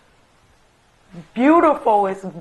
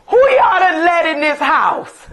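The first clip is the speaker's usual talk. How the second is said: higher, louder mock yell with a laugh; retold yell; 7 semitones higher, 5 dB louder than usual. Mother yelling downstairs at her children, amused. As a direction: call down the stairs, half laughing. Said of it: "I yelled from upstairs".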